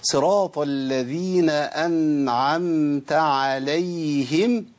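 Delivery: very slow chanted recitation ending عليهم with a kasra; model recitation. Shown as a demonstration. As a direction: recite slowly, ending in عليهِم. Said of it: "تسمع كده".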